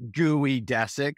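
slow "gooey-DESS-ick" for geodesic; mispronounced geodesic. Acted. Pete mocking his own mistake, geodesic slowly mispronounced as gooey-dessic. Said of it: "Pete's incorrect pronunciation of geodesic".

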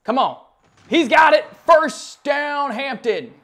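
loud, high cheer; excited cheer. Real, not acted. Gamer cheering his first down, loud and high. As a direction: yell it like the play just hit.

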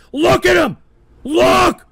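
high, loud, insistent shout; worked-up angry shout; exasperated yelling. Real, not acted. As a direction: yell it like pointing at culprits. Host rants at chat room.

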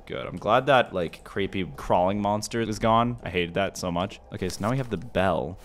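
ordinary delivery

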